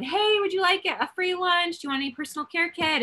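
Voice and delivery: high, bright call-out through a car window; high friendly call to a passerby; cheery call from the car. Retold or imitated. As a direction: sing out a friendly offer to a stranger. Retelling calling out from the car.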